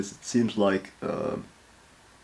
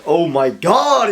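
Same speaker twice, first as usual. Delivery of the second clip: excited gasp; 7 semitones higher, 7 dB louder, slower than usual. Real, not acted. Reacting to character trailer.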